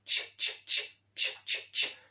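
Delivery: soft, voiceless ch repeated on breath alone; unvoiced ch. Shown as a demonstration. Drilling the sound from chin, unvoiced ch. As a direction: puff out each ch without any voice.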